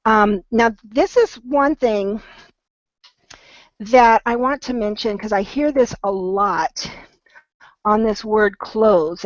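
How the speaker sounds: ordinary talk, nothing marked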